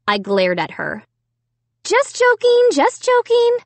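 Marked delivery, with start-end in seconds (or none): 1.8-3.6 high-pitched sisterly backtracking; insistent. A narrator's reading. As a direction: insist it was only a joke, much higher. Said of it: "my sister insisted".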